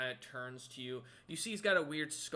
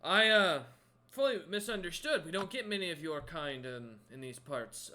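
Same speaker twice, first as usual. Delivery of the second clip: loud, higher-pitched, hesitant apology easing off; apologetic crewman; 4 semitones higher, 8 dB louder than usual. Acted. Crewman excusing his misunderstanding to the stranger, loudly apologetic. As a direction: backpedal loudly, then settle down. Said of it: "and he goes".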